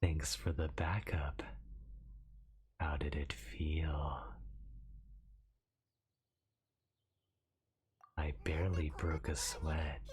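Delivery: soft slow whispery yandere; breathy creepy obsessed whisper. Acted. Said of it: "I'll try this in yandere".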